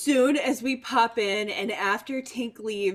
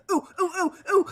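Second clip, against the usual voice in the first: excited squealing; 8 semitones higher, faster than usual. Acted. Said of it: "she's just like".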